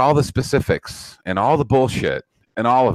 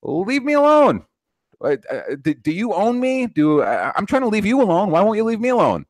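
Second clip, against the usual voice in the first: high, pleading cry to be left alone; pleading exasperation; 8 semitones higher than usual. Retold or imitated. Arguing with critics, exasperated high plea to be left alone. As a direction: throw up your hands and plead to be left alone. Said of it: "Just like".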